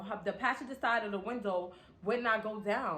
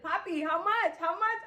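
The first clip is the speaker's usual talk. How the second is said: high, rising sweet-talk how much; coaxing ask; 11 semitones higher, 4 dB louder than usual. Retold or imitated. Pressing the mechanic for the price, sweet and high.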